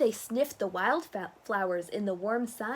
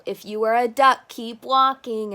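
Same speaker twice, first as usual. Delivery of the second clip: loud; 2 semitones higher, 10 dB louder than usual. Acted. Reading a sign.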